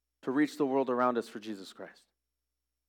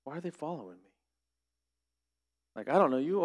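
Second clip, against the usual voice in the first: suspicious muttering; 6 dB quieter than usual. Retold or imitated. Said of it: "walk on the other side of the street and go".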